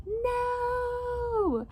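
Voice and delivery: high, long, loud mock wail; dramatic retold scream, held long and high; drawn-out cry of "no". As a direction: act out a horrified, stretched-out no. Retold cry as Rudy pours chemical.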